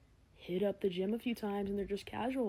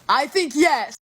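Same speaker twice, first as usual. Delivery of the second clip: loud; 4 semitones higher, 13 dB louder, slower than usual. Acted. Mock decisive answer.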